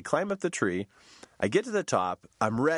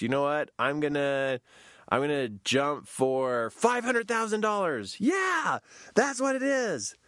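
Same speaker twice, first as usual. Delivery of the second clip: high excited declaration; excited announcement; 6 semitones higher, slower than usual. Retold or imitated. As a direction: voice shooting high on the big goal. Announcing a wild goal.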